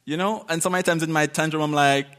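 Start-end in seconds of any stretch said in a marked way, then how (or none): none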